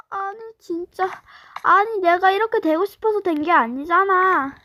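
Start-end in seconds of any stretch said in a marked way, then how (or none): none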